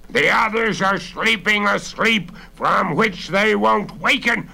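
high, loud taunting villain; menacing robber. A narrator's reading. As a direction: taunt the trapped girl loudly. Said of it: "as the voice continued".

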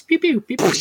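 high laser pews; playful vocal laser fire; mouth-made sound effects. Shown as a demonstration. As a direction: demonstrate homemade laser zaps. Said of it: "we make the sound effects".

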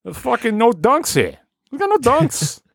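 high-pitched Boston complainer; Boston accent. Acted. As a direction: gripe in an exaggerated, squeaky-high Boston accent. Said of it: "that has that real accent".

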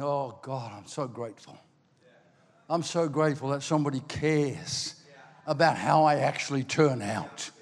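soft, low retold prayer of thanks; humble gratitude. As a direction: hush it right down, sincerely thankful. Older self retold, humbly thanking God.

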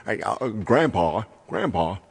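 put-on sitcom character calling out; Herman Munster imitation. Recalling the original show, put-on character voice. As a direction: imitate a TV dad calling his grandpa. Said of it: "he'd always talk like that".

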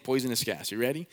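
ordinary talk, nothing marked

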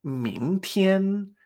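"mingtian" climbing to a level high note; tones two-one. Shown as a demonstration. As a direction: rise on "ming", sustain "tian" high. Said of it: "la combinación dos uno".

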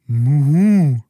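loud, high moo pushed out; a troubled cow lowing; moo blurted out. Acted. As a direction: burst out with a sad cow moo. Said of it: "stieß Carola hervor".

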